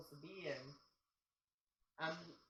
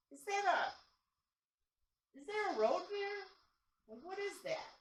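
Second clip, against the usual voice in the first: puzzled; 12 semitones higher, much slower than usual. Retold or imitated. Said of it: "I'm going".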